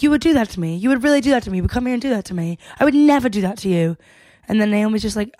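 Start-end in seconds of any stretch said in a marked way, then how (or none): 0.0-4.0 worked-up mock British accent, rushing as it goes; attempted British accent. Jess freaking out on Naomi, in an imitated British accent. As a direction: imitate an accusing Brit, higher at first. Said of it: "are my accents good".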